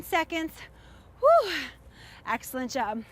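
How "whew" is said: relieved whew. Real, not acted. Exhale after hard set.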